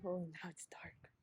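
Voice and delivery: whispering. Real, not acted. Sudden darkness while filming.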